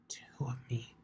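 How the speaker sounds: soft unvoiced whisper to herself. Twilight whispering to herself on the way home. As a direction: breathe it as a private realization. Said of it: "she whispered".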